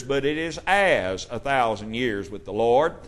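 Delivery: "as" lifted and stressed over "is". Shown as a demonstration. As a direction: make "as" the key word. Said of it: "Notice there is a difference in as and is".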